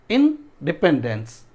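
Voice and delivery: इंडिपेंडेंस slowly, pause after इन; syllable breakdown. Shown as a demonstration. Teacher asking students where stress falls, slow split word. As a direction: split off इन in इंडिपेंडेंस, then the rest. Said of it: "कहाँ पे stress पड़ रहा है".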